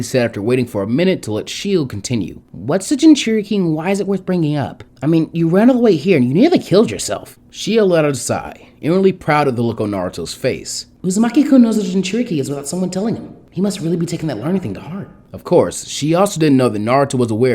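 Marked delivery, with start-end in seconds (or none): 2.5-7.4 curious Ino. A narrator's reading. Ino asking. 11.0-15.1 Shio's voice. A narrator's reading. Shio higher.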